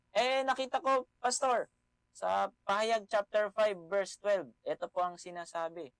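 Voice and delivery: high, loud challenge to the pastor; eager objector. Acted. A church member telling the pastor what they found, high and insistent. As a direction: pipe up excitedly with a found proof text.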